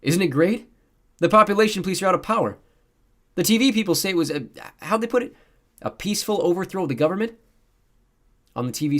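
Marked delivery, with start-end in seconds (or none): 0.0-7.3 put-on high, loud boyish excitement; beaming. A narrator's reading. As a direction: gush happily with the voice lifted high. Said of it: "The boy next to him beamed".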